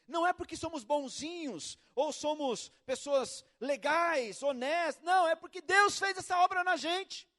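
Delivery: preaching. Acted. Pastor preaching to the church, emphatic. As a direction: preach it with rising force.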